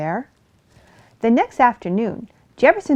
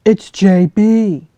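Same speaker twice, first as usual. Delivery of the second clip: loud, slow hopeful call; 11 dB louder, slower than usual. Acted. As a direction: call into the hollow tree. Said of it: "he called".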